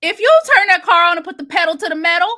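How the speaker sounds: fast and fired up; fired-up rant. Real, not acted. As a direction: push it out fast and loud. Heated rant about the victims.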